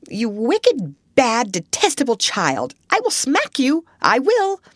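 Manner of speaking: high-pitched, exasperated scolding; frantic outburst rising to a squeal; furious threat. A narrator's reading. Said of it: "then in quite another tone".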